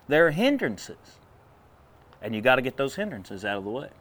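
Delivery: ordinary delivery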